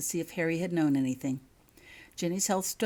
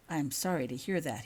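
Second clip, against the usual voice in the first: ordinary delivery, nothing marked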